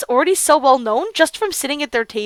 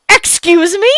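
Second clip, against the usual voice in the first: raised, higher acted call; mild shout; 5 semitones higher, 5 dB louder, slower than usual. Girl breaks into two boys' bickering, mild shout. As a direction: cut in with a moderately loud call. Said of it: "I shouted into the middle of them".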